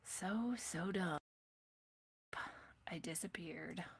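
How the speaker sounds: breathy whispered aside; whispering. Real, not acted. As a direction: drop to a whisper for yourself. Aside while fixing the stream.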